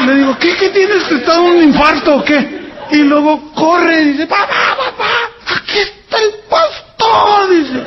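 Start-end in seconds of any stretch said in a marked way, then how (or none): 0.4-2.6 alarmed question. Retold or imitated. Pastor asks daughter what's wrong. 4.3-7.5 excited calling out. Retold or imitated. Daughter calls father, overwhelmed.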